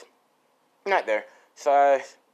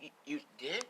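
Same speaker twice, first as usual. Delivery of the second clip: breathless whisper; 11 dB quieter than usual. Acted. Girl surprised by confession.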